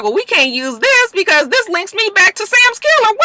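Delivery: very high, worried voice; lightly hammed-up worry; high mock fret. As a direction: whine it anxiously. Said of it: "she was just like".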